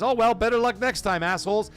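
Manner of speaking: ordinary talk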